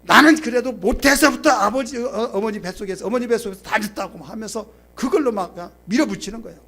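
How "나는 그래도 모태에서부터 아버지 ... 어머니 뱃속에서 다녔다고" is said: high, insistent husband pulling rank; heated, stumbling retort in a retold quarrel; worked-up boasting. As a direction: imitate someone angrily bulldozing an argument. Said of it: "막 화가 나면 막 흥분되면".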